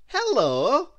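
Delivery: high, loud cheery hello; grinning Mister Happy. Acted. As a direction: greet her with a big grin. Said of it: "grinned Mister Happy".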